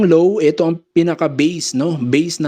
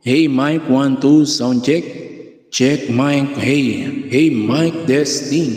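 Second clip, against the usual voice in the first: drawn-out sound check with echo; echo effect; much slower than usual. Shown as a demonstration. Sound tech testing the added echo, voice echoing. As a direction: call out mic checks through an echo. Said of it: "nagkaroon na siya ng echo".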